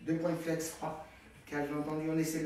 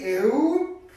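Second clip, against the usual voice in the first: cheerful shout; 8 semitones higher, 13 dB louder than usual. Real, not acted. Coach cheers finishing move.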